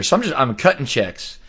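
normal talking voice

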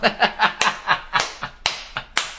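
long breathy cackle trailing away; real laugh. Real, not acted. Storyteller cracking up at his punchline, long breathy laugh. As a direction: laugh helplessly until the air runs out.

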